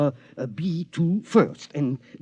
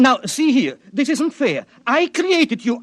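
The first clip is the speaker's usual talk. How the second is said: higher and rising in panic; frightened protest; 7 semitones higher, 4 dB louder than usual. Acted. Inventor protests to the robot aiming at him, rising panic. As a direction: plead in rising panic with the gun on you. Inventor facing his creation's gun.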